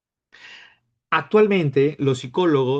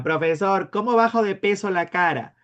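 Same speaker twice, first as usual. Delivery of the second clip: higher-pitched imagined student asking; mock student query, pitched up; student's question; 6 semitones higher than usual. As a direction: pipe up like a curious pupil. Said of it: "algunos de ustedes me preguntarán".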